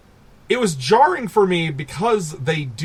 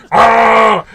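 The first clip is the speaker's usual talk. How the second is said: mock scream; 7 semitones higher, 10 dB louder than usual. Shark attacks bikini women.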